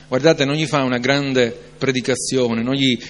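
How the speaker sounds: ordinary talk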